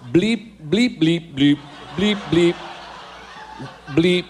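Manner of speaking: imitated robot beeping in short bursts; robot bleeps. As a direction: bleep like a toy robot, jumping in pitch. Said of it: "I am a robot now".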